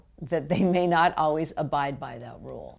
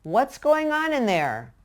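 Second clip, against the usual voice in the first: slow, easygoing call to the next room; calm, casual call; 8 semitones higher, 4 dB louder, slower than usual. Shown as a demonstration. Parent calls calmly to kids in another room. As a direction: ask lightly, as if it hardly matters. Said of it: "react very calmly".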